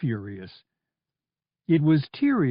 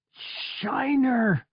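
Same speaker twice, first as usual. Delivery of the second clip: much higher, a falling cry of loss; anguished cry; 11 semitones higher than usual. Acted. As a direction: call out the lost droid's name. Donos cries droid's name in grief.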